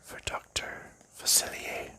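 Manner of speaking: ordinary talk, nothing marked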